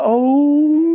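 long, high "oh" rising in pitch; hammed-up imitation of her tearful wail; mother-in-law's crying wail. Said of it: "that's her thing".

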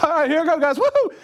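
cool friend whooping. Retold or imitated.

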